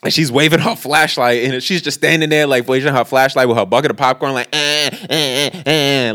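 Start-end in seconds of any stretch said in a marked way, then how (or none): 4.5-6.1 taunting little repeated noises; antagonizing. Retold or imitated. Girl waves her flashlight at him, antagonizing. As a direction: imitate someone needling him with small sounds. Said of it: "like, antagonizing him".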